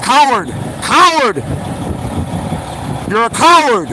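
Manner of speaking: yelling coward, loud and high; furious yelling at a rival who wronged him; angry shout. Real, not acted. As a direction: spit the word out with contempt. Shouting insults at rival on stream.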